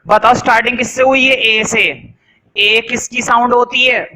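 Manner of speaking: normal talking voice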